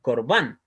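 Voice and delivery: "korban" with the stress landing on "ban"; stress on final syllable. Shown as a demonstration. Teacher joining both syllables into the word, "korban" stressed on "ban". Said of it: "Esa sílaba van sí está acentuada".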